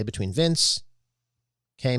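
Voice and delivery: usual speaking voice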